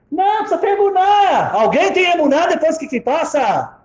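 very high, loud indignation; heated protest. Retold or imitated. Old woman disputing advice to have faith, heated protest.

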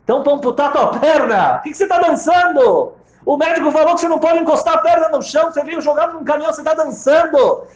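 high, loud outraged shout; incredulous scolding. Acted. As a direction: yell at him in disbelief. Friend scolds dancing Gedalia.

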